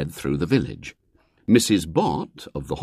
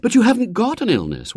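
mother objecting, higher; a mother calling his bluff; protesting mother; 3 dB louder than usual. A narrator's reading. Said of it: "protested his mother".

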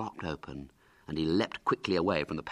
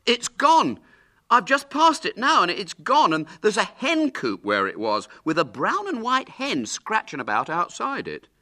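loud, high-pitched breaking news; feigned alarm; 8 dB louder than usual. Pretend. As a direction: report it as if astonished. Douglas fakes alarming news.

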